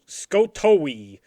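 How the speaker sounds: Skotowi said slowly, syllable by syllable; slow careful name. Shown as a demonstration. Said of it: "S K O".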